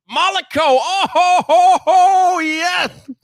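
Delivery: high laughing hype shout; excited welcome. Real, not acted. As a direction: cheer a favourite regular. Excited welcome of regular viewer.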